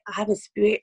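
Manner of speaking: ordinary delivery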